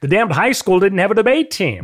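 loud, high exasperated complaint; exasperated, voice jumping up; indignant. Real, not acted. Indignant complaint about the school.

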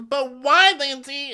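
high-pitched dramatic protest; hammed-up whiny "why"; mock wailing girl. Girl demanding a reason from Lindsay, mock wail. Acted quarrel between friends.

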